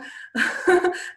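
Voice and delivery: high, loud burst of laughter; amused laugh. Real, not acted. Teacher confessing her lipstick habit to students, amused laugh. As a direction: laugh at your own silly habit.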